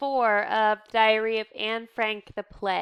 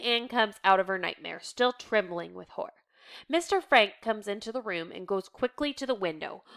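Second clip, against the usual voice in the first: ordinary talk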